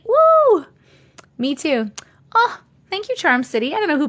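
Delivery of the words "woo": high, bright cheer of delight; excited woo. Real, not acted. Cheering a viewer joining the stream, excited woo.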